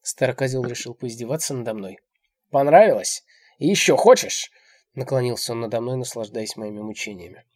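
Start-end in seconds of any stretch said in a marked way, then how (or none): 2.5-4.5 loud, high jeering questions; taunting. A narrator's reading. Old man gloats over her pain, taunting.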